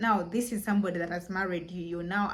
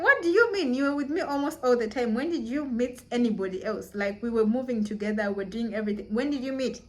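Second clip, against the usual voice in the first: shocked disbelief; 3 semitones higher than usual. Retold or imitated. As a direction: burst out in disbelief. Retold shocked outburst.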